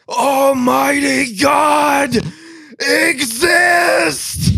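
very high, slow, crying shout; wailing proclamation. Retold or imitated. Retelling the tripper's best line, wailing shout to the sky. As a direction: bellow it out like a prophet in anguish.

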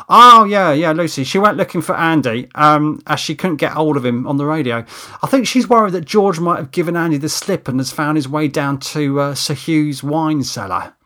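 voiced Steve, animated. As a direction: launch in loudly as if just remembering, then explain. Steve explains where Lucy went.

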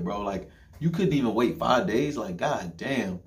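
ordinary talk, nothing marked

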